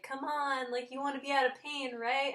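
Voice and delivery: high, quick cajoling imitation; coaxing medical staff. Pain team persuading a reluctant patient, coaxing. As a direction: pitch it up and talk the patient round. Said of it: "they were just like".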